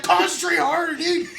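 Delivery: high, loud, urgent order, laughing through it; exasperated mock shout. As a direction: reenact yelling at a useless gunner. Retold shouted order to gunner.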